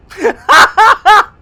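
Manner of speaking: high, very loud burst of laughter; delighted cackle. Real, not acted. Streamer delighted by their own rhyme, loud cackle.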